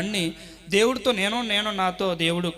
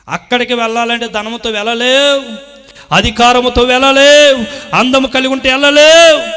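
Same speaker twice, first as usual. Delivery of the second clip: loud, higher preacher's cry; preaching at full force; 7 semitones higher, 16 dB louder than usual. Acted. Preacher thundering to the congregation, full force. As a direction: drive each line home to the church.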